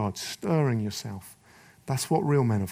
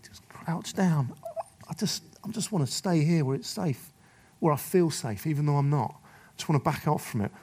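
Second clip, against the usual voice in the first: mock timid; 2 semitones higher than usual. Preacher voicing men hiding when life gets difficult, mock timid. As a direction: shrink back, pleading to stay safe.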